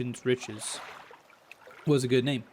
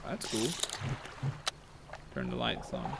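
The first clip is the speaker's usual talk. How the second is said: ordinary talk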